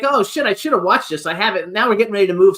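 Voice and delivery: usual speaking voice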